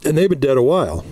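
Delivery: ordinary talk, nothing marked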